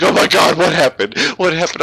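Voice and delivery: mock alarmed wife, high and loud; imitating panic. Wife jolted awake imitated, high loud alarmed questions. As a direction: play her startled fright at being woken. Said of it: "she's freaking out".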